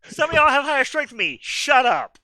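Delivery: high, loud, fast retort; loud protest. Real, not acted. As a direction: push back hard on the teasing. Loud bantering protest to teammates.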